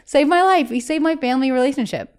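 high-pitched gush, loud then easing off; excited gushing. Retold or imitated. Thanking Carson at the Today Show, excited gushing.

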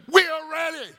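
high, loud battle cry; roaring soldiers' response; army's unison shout. Acted. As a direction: shout it as a whole army. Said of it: "they would respond in unison".

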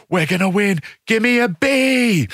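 rally the team like a cheerleader; rallying team cheer. Retold or imitated. Team captain firing up the blue team, rousing mock cheer. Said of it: "tries to take that into, like".

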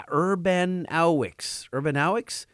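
Urbanowicz as UR-ban-OW-wicks; sounding out Urbanowicz with OW and a final ks; unsure pronunciation. Real, not acted. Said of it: "How would you pronounce his last name".

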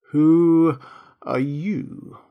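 drowsy drawn-out caterpillar question; languid, sleepy. A narrator's reading. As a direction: draw each word out lazily, with pauses. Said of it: "addressed her in a languid, sleepy voice".

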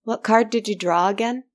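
high-pitched call up to the sky; shouted question. A narrator's reading. Knot Mother calls up to the distant storyteller, shouting. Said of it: "looked up to the sky and shouted".

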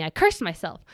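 normal talking voice

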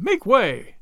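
men crying out. A narrator's reading. Men all about him, crying out high. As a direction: call for room with a lifted voice. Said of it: "cried the men all about him".

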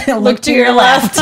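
repeated through a laugh, slowly; giggling as she quotes the line. Retold or imitated. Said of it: "And Catherine goes".

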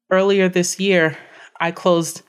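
ordinary talk, nothing marked

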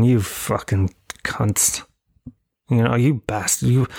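mild mock grumble at the pub crowd; retold grumbling. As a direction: grumble the insults under your breath. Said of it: "I was grumbling".

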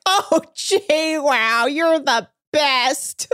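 drawn out, slow, sarcastic praise; a hint of a fawning captain, stretched slowly; mock gushing. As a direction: ham up the fake admiration. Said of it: "And Captain Sandy's like".

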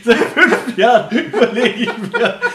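loud, slow words through laughter; laughing while talking. Real, not acted. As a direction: talk while cracking up. Proud of long-saved joke.

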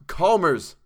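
high, loud appeal by name; pleading. A narrator's reading. Narrator trying to quiet Chalmers, pleading loudly. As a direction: plead over his raving. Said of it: "I pleaded to quiet him".

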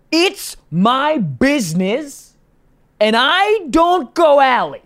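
slow, high mock yell; retold yelling. Driver refusing to enter the alley, retold slow yelling. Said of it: "Yelling, yelling at me".

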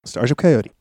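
a hint of a cocky wrestler, low and laid-back; Starship Coyote impression. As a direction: toss it off like a cool heel wrestler. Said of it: "So here's my Starship Coyote impression".

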